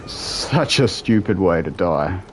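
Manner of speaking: ordinary talk, nothing marked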